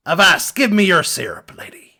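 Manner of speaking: whispered pirate demand; whispered, conspiratorial pirate. Acted. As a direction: hiss it like a sneaky pirate.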